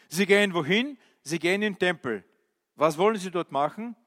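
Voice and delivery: ordinary talk, nothing marked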